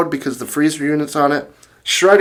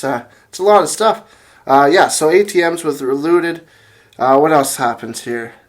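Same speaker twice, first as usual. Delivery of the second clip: usual speaking voice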